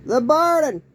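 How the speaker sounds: shrill high-pitched shout of the word, falling at the end; high yell. Retold or imitated. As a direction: scream it out loud in a falsetto. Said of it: "I started yelling".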